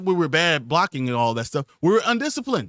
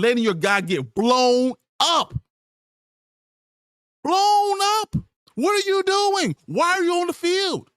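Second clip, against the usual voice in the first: furious yelling; 11 semitones higher than usual. Real, not acted. Ranting furiously at a player.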